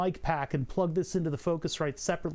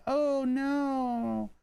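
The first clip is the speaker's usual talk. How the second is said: mild oh no; 7 semitones higher than usual. Retold or imitated. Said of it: "and I was like".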